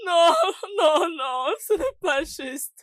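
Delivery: sobbed denial, slowing down; grief-stricken crying. Acted. Daughter learns her mother is dead, sobbing. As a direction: wail your refusal through tears.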